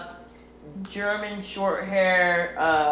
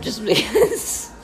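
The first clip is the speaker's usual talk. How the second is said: giggling through it; laughing; 5 semitones higher, 4 dB quieter than usual. Real, not acted. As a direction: laugh as you start. Laughing while answering.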